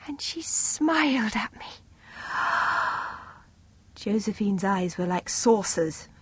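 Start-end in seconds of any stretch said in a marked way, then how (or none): 0.1-1.7 awed whisper. A narrator's reading. Awed description. 2.2-3.2 soft unvoiced gasp; awed gasp. A narrator's reading. Gasp after telling.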